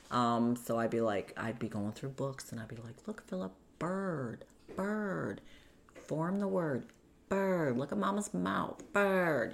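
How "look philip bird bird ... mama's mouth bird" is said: put-on higher, gentle coaxing voice, as if to a small child; coaxing mother. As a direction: coax the boy to say the word.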